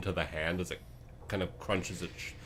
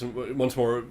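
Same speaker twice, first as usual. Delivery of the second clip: ordinary delivery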